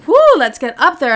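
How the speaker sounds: high, loud hyped-up woo; mock eager whoop. Telling the story, her past self cheering before sex, mock hyped. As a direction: cheer herself on, hammed up.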